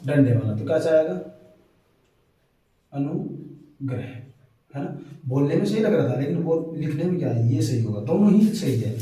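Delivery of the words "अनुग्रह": अनुग्रह drawn out slowly and softly; slow careful spelling-out. Shown as a demonstration. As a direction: stretch अनुग्रह out, stressing the ग्र. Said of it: "ये वाला रे कौन सा". (अनुग्रह को धीरे धीरे, ग्र पर ज़ोर देकर बोलना)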